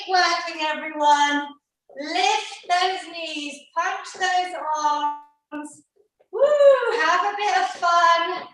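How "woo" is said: high excited whoop; cheer. Real, not acted. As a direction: whoop to lift the class. Cheering class on during boxing.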